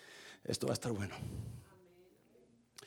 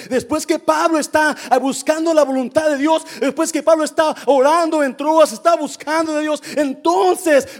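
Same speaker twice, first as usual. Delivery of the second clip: full-force preaching; 8 semitones higher, 8 dB louder than usual. Real, not acted. Preacher reading Paul's vision to the church, full-force preaching. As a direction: preach it loud and high, stretching key words.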